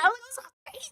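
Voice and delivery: tiny high-pitched, very soft voice; high, hushed re-enacted sorry; squeaky apology. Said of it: "I said".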